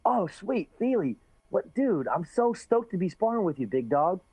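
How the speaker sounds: quoting Sage's friendly greeting before sparring; Sage impression. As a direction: gush like a stoked young fighter. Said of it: "He goes".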